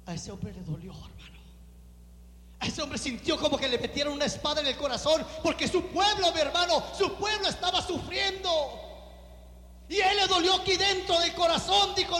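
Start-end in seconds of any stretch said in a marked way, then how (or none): 2.6-12.2 impassioned preaching. Acted. Pastor to congregation on Nehemiah's grief, impassioned preaching. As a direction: preach it with fire and ache.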